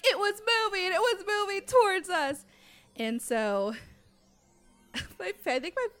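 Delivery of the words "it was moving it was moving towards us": much higher, louder, urgent insisting; insistent retold protest. Kids insisting to firefighters, high urgent protest. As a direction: insist desperately it was real. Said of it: "We're like".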